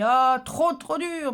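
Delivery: a loud, high, sarcastic "trop dur"; mock sarcasm. Replying to the viewer's tip, mock sarcastic dismay. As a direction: fake being overwhelmed, dripping irony. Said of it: "Je dis".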